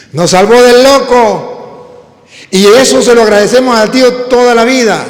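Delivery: full-voiced sermon climax; preaching at full force. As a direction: proclaim it to the congregation. Preacher booms the story's moral.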